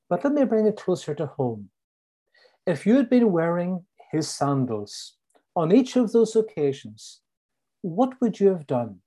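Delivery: usual speaking voice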